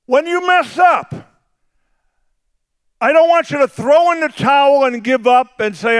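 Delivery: high, loud, shouted sermon delivery; full-force preaching shout. Acted. Pastor thundering Jesus's words to Peter. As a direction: preach it with everything.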